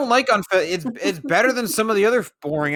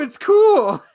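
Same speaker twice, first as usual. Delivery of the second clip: high, loud heated exclamation; excited shout; 10 semitones higher, 5 dB louder than usual. Real, not acted. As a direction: shout it, fired up. Heated Pokémon argument.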